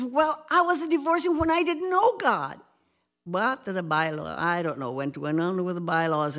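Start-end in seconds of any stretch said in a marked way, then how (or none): 0.0-2.6 indignant protest. Retold or imitated. Retold protest.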